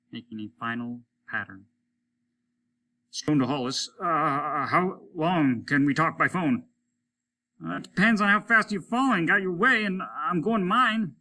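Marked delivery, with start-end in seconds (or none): 3.1-6.7 slightly raised radio call; a hint of a distant radio voice; astronaut on the radio. 7.6-11.2 high, louder strained voice; tense spaceman calling back; a voice straining to carry over the radio; astronaut on the radio. Acted. Stone answers over radio.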